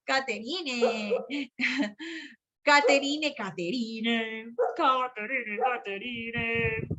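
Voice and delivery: teasing name-calling. Real, not acted. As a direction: chide her playfully, stretching the name. Teacher teasingly calls student's name. (llamada burlona y lenta)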